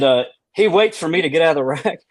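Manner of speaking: ordinary talk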